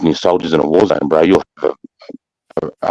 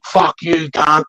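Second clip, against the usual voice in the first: retold swearing back; 9 semitones higher, slower than usual. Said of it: "And I'm like".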